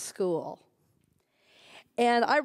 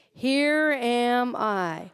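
slow, reverent; much slower than usual. Shown as a demonstration. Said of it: "Say that with me".

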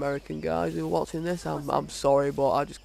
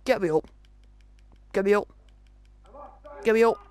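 getting more desperate with every ask; pleading for help; 4 semitones higher, faster than usual. Real, not acted. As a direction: player downed in the game, calling to his partner with rising panic. Downed player begging for revive.

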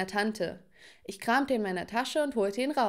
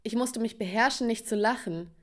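ordinary delivery, nothing marked

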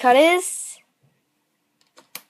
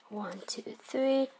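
whispered count under the breath; whispering; 2 semitones higher, 11 dB quieter than usual. Real, not acted. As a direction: hush it, counting softly.

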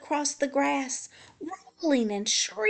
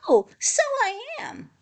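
high, delighted realisation; 14 semitones higher, 4 dB louder than usual. A narrator's reading. Hedgehog realises he is the prickliest, delighted. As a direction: swoop up high with pleased surprise.

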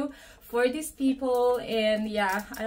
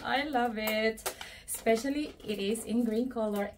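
usual speaking voice

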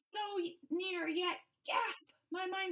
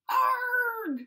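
high loud cry of pain; pained scream; 8 semitones higher, 10 dB louder than usual. Acted. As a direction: scream as if scalded. Scalded by hot water.

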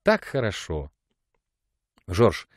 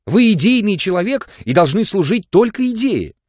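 в отчаянии; 8 semitones higher, 5 dB louder than usual. A narrator's reading. Отчаянные упрёки Зинаиды.

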